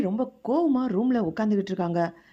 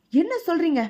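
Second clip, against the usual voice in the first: sharp, much higher and louder angry demand; 9 semitones higher, 5 dB louder than usual. Acted. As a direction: boss angrily confronts his office staff. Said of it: "அப்படின்னு கோவப்படுறாரு".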